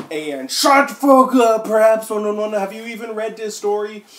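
high, loud, slowed mock rant; loud, high, hammed-up tantrum; ranting author's note. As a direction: yell it at the haters like a furious teen. Reading the author's angry note.

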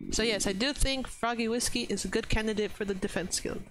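normal talking voice

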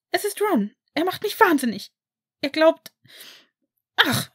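high, loud upset outburst; furious and tearful. A narrator's reading. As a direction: burst out in high, loud frustration. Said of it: "eine offenbar sehr wütende Hermine".

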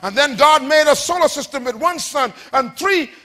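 high, rhythmic sermon cadence; driving preacher declamation; impassioned preaching. Real, not acted. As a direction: pile up the list with rousing force. Preaches at full force.

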